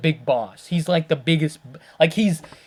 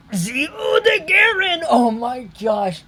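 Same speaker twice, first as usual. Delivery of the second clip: high, slow, delighted squeal of a fan; excited squeal; 10 semitones higher, slower than usual. Real, not acted. Fan gushing over the Odogaron, high delighted squeal. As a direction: squeal the name like a giddy fan.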